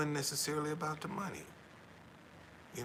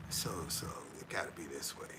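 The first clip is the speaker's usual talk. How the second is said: whispered aside; 7 semitones lower, 5 dB quieter than usual. Real, not acted. Muttered aside while searching photos.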